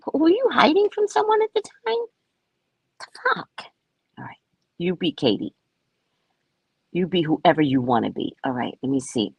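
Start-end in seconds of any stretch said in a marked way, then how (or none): 0.1-3.7 incredulous. Pretend. Mock-incredulous questioning.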